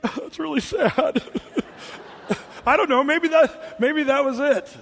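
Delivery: high, laughing through the words; real laughter breaking into speech. Real, not acted. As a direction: crack up at your own joke. Preacher cracks up at own joke.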